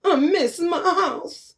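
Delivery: high, slow, laughing mimicry; mock a whiny girl, laughing. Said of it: "What? Who is that?".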